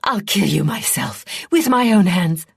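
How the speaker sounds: hissed threat building to a loud high shout. A narrator's reading. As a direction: princess swearing murderous revenge. Princess threatening Sinead.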